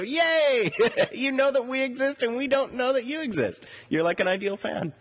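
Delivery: high-pitched excited cheer with a laugh; gleeful cheer. Real, not acted. Host cheering a total stranger who follows the show, gleeful. Cheering an unknown new listener.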